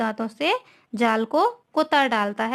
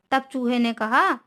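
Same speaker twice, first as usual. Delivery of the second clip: ordinary talk, nothing marked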